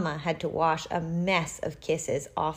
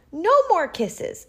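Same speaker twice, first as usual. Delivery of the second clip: higher, louder protest; firm refusal; 11 semitones higher, 5 dB louder than usual. A narrator's reading. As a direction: refuse more kisses, high and loud. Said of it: "said Bernard".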